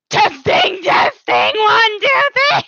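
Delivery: loud rising mic check; mic check call. Shown as a demonstration. Mic test calling out.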